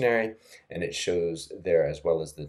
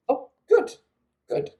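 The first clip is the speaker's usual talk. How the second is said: high, bright exclamation of relief; sudden delight that it works; pleased surprise; 5 dB louder than usual. Real, not acted. Teacher pleased the free chatbot answers right, surprised. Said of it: "reacting to the chatbot working".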